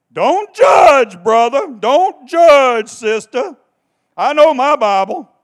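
loud, high mocking "Don't judge"; mock shout. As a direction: shout down their objection in mockery. Mocking the objectors.

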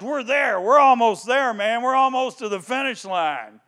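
rally toward the finish; pumped. Retold or imitated. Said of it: "They're pumped".

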